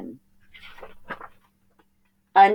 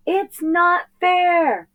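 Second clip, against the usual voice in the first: high, slow sulky whine; whiny complaint; 4 dB louder, much slower than usual. Acted. Boy sinks into the sea, whining high and slow. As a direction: stretch it out like a sulking kid.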